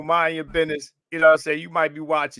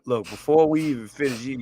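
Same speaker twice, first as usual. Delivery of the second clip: usual speaking voice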